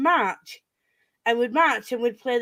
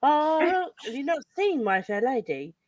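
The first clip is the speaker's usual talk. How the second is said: normal talking voice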